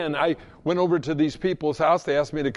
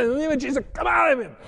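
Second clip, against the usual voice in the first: much higher, climbing rebuke of a demon; forceful command; 9 semitones higher, much faster than usual. Retold or imitated. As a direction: drive it out at full force. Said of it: "I said".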